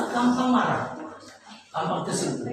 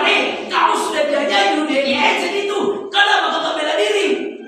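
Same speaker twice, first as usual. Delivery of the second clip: Pilate demanding; 6 semitones higher, 4 dB louder than usual. Retold or imitated. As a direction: demand why he won't defend himself. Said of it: "Pilatus bilang".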